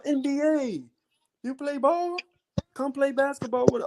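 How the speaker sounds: mock high eager Filipino greeter voice; high imitation of locals greeting. Filipino locals greeting him on the street, high mock voices. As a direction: squeak it out like excited strangers.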